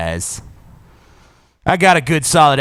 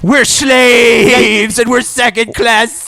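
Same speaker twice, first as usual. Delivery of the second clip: higher, louder mock whine; whiny victim voice; 8 semitones higher, 6 dB louder than usual. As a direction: whine like a self-pitying victim. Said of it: "they all have victim complexes".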